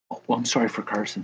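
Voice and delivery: ordinary delivery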